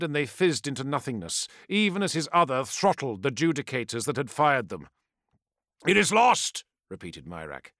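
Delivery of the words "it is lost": urgent warlord. Acted. Warlord urging retreat.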